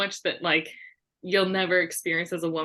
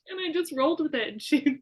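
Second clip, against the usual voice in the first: normal talking voice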